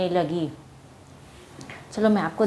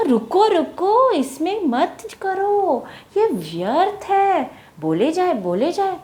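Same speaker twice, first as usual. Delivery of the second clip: insistent bird; 8 semitones higher than usual. Acted. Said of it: "बोला".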